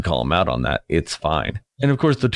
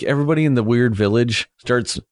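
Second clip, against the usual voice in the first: ordinary talk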